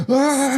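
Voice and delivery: high-pitched mock agonised "ah"; imitated electrocution cry. Podcaster imitating Bennett hitting an electric fence, high mock cry. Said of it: "Ben is like".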